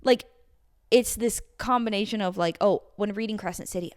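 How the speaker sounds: ordinary delivery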